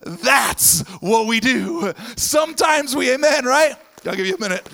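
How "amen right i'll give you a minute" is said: ordinary delivery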